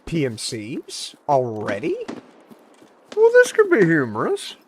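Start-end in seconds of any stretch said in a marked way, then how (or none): 3.2-4.6 much higher, louder mock sing-song. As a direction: sing it up high, teasing. A dangerous enemy appears.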